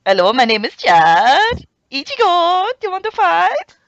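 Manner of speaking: loud, high mock Mexican accent; hammed-up Mexican accent, shouted. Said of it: "start talking with a Mexican accent".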